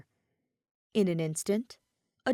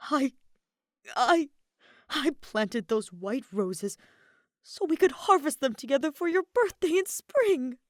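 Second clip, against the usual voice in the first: shaking with anger; 7 semitones higher, 4 dB louder than usual. A narrator's reading. Kaveh scolding his husband over the roses, shaking with anger. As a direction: voice climbing as the hurt spills out. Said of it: "he was shaking from anger".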